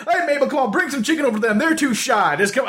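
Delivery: high, eager Southern greeting; friendly Southern dad. Retold or imitated. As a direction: play the overfriendly neighbor dad. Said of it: "to his wife".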